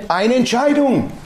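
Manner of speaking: slow, lifted stress on Eine Entscheidung; emphatic. Real, not acted. Preacher driving the point home, slow emphasis. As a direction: spell out Eine Entscheidung slowly. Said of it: "preacher stresses decision".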